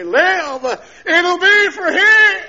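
slow cry building to a high shout. Retold or imitated. Injured man vowing his life to God, crying out. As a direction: start drawn out, then holler the vow. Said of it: "I cried out in my voice".

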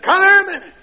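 calling out. Retold or imitated. Preacher calling him over again.

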